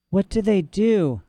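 put-on slow, drugged child; groggy. A narrator's reading. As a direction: struggle to ask while drugged. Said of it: "Lyra managed to ask".

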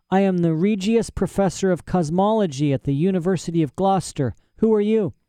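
put-on high-pitched, self-important old scholar; pompous mad professor. Imprisoned professor introduces himself to Lyra, pompous.

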